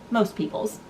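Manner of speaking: normal talking voice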